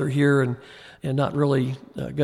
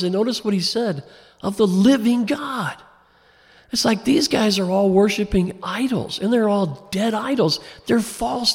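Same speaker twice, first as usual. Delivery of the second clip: higher, impassioned preacher's voice; fervent preaching; 5 semitones higher than usual. Acted. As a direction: preach it with rising fire. Preaching builds to a peak.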